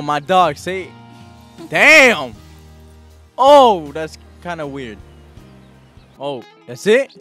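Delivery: ordinary delivery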